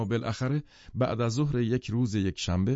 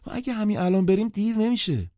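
high, raised woman's voice; loudly protesting, pitched far up; loud reply; 12 semitones higher, 5 dB louder than usual. A narrator's reading. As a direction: answer a whisper out loud for all to hear. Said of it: "او بلند جواب داد".

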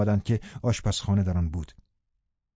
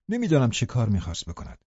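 usual speaking voice

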